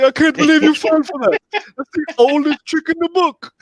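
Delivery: loud, high, gloating villain line; a hammed-up smug taunt; gloating movie quote. Retelling the Spaceballs ring scene, mock gloating. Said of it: "He was like".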